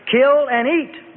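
slow, high, loud divine command; stern command from heaven. A narrator's reading. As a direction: lay down the order slowly. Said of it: "the voice commanded".

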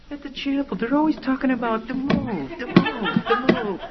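high, goofy over-acted parroting; bumbling junior demon. Rookie demon tries the line on the girl, bumbling. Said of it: "Junior goes up and he goes".

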